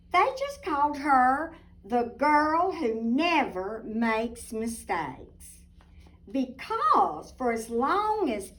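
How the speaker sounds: put-on lively storybook narration for little girls; reading to children. A narrator's reading. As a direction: read it like a bedtime picture book. Picture book read aloud.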